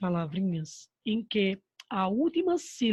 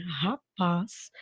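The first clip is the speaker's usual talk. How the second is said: "rapaz" split slightly, with "paz" as the strong syllable; teacher sounding out "rapaz", stressed on "paz". Shown as a demonstration. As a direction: lean on the final "paz" in "rapaz". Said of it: "a sílaba tônica dessa palavra é o paz".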